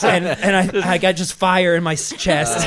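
loud, high comic distress; acted pained yelling. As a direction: blurt it out in mock agony. Goes on acting his injured self.

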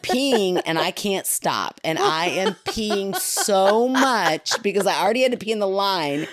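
usual speaking voice

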